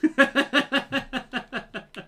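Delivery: high burst of laughter; laughing. Real, not acted. Streamer laughing at the failed Scouse attempt, real laughter. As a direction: crack up at your own bad accent. Cracks up at own accent.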